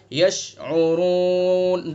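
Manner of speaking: यशउरून with the ऊ held three alifs; three-alif stretch. Shown as a demonstration. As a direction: hold the ऊ in यशउरून for three counts. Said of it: "तीन अलिफ". (यशउरून तीन अलिफ खींचकर)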